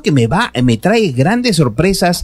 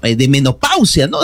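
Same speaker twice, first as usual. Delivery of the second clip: ordinary talk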